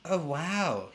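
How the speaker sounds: high-pitched gasp of amazement. A narrator's reading. Dentist sees inside the fox's mouth, gasping in surprise. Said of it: "he gasped".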